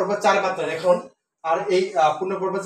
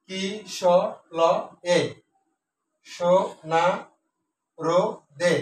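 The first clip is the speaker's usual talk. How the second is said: each syllable spoken alone with pauses between; much slower than usual. Shown as a demonstration. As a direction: chop the verse into single syllables, slowly. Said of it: "বনটা একসাথে উচ্চারণ করে".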